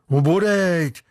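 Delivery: hearty agreement. A narrator's reading. Second sailor agreeing with his mate, hearty. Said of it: "ozvalo se přitakání".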